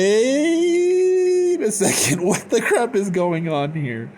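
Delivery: very high, drawn-out first word, then giggly; hammed-up shock. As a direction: squeal it slowly in comic disbelief. Acted shock at letter from dead.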